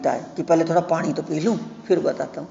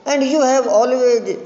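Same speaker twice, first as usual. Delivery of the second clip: nervous suitor; 6 semitones higher, much slower than usual. Acted. Lomov flatters the neighbour.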